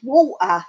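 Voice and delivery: "Walter" as waw'-uh, loud; imitated dropped T. YouTuber showing how England says it, imitated dropped T. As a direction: swallow the t in "Walter" with a glottal stop. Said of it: "That's how you say it in England".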